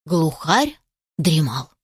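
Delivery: plain reading voice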